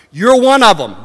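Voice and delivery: high, loud accusing call; loud accusation. Acted. Servant girl addresses Peter directly, loudly accusing.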